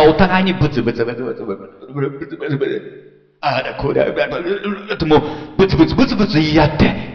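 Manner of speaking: rapid muttered grumble imitation; mock grumbling. Preacher mimics crammed Christians complaining to each other, fast mock grumbling. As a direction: rattle off the mumbling of squabbling believers. Crowd grumbling in a dream ship.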